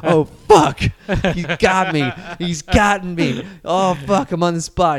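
hammed-up inner panic; mock panic. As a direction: play at realizing you have been caught. Voices teen's panic at exposure.

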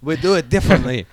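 mock French accent; a fancy French pirate explaining himself. Imagining how the French crew pirates, mock French accent. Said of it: "like, femmy about it".

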